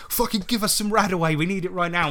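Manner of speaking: high-pitched urgent plea; frantic begging of an employer, re-enacted; retold frantic demand. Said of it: "went back to our employer like".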